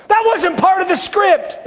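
high, loud cry of disbelief; a bewildered believer shouting at God; protesting shout. Acted. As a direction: throw the complaint up at heaven.

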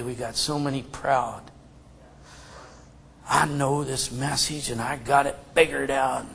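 low, soft, solemn preaching; real quiet, low earnestness; hushed humility. Real, not acted. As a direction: drop right down, sober and quiet.

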